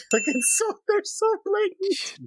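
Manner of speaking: very high, squealed words through laughter; helpless laughter. Real, not acted. Podcaster cracking up over a blatant shot, squealing with laughter. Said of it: "I'm sorry, I'm laughing".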